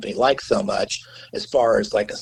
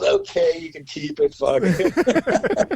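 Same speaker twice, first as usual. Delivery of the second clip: much higher voice breaking into laughter; laughing concession, imitated; 11 semitones higher, much slower than usual. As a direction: give in through rising laughter, as a retold quote. Producer laughingly gives in, acted out.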